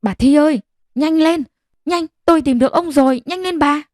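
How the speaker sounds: high urgent calling out, breathless; shouting call. Acted. Chú Thạch calling Bà Thi to hurry, high breathless shout. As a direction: yell for her to hurry, pitched high. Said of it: "vừa thở hồng hộc vừa hét gọi".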